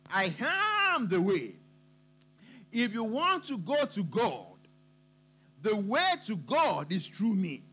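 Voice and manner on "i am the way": slow, deliberate "I AM THE way"; emphatic declaration. Shown as a demonstration. Preacher demonstrates emphasis in Jesus' words, slow and deliberate. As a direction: hold AM long, then place THE firmly. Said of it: "Emphasis on thee".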